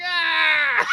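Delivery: high shouted cry; child scream. Retold or imitated. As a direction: scream like a startled kid. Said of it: "Barry's like".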